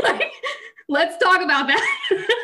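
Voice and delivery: high, loud, slow through laughter; incredulous laughing outburst. Real, not acted. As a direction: exclaim it while cracking up. Cracking up over grim family history.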